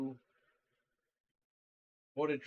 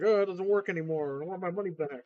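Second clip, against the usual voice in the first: louder, higher mock complaint; imitated customer complaint; 4 semitones higher, 7 dB louder than usual. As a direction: imitate an indignant customer demanding a refund. Imitated customer complaining about broken purchase.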